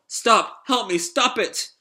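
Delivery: high, urgent cries for help; panicked android. Acted. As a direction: plead in short, high bursts. Pino begs as leg is attacked.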